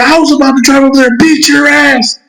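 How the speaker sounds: high, sustained mock scolding; hammed-up mom tirade; retold angry mom. Voices mom threatening him.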